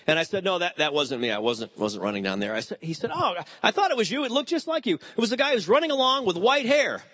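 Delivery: usual speaking voice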